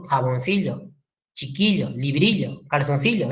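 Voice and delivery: ordinary talk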